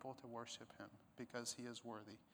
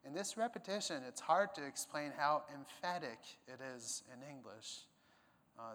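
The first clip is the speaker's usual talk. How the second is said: ordinary delivery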